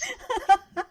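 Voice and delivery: a high burst of laughter. Real, not acted. Laughs at own joke.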